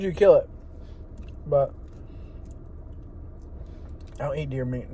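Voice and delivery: ordinary delivery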